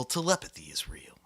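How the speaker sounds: spooky whisper. Acted. Host leading listeners through the scary door in a whisper. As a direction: hush it like a ghost story.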